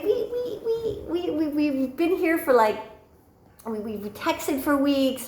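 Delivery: high-pitched stammering protest; indignant spluttering. Retold or imitated. Woman protests being turned down after weeks, high and indignant.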